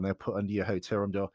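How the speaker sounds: normal talking voice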